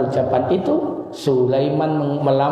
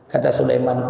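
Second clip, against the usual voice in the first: usual speaking voice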